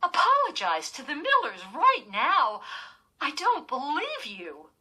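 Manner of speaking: loud, high scolding order; stern order. Acted. Mother ordering her daughter to apologize, loud and high. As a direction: demand the apology, no room to argue.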